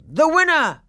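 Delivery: high, loud triumphant cry; crowd's loud triumphant shout. A narrator's reading. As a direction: call it out like a cheering crowd. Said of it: "crying out".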